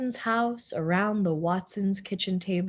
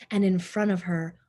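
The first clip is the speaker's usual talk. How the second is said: plain reading voice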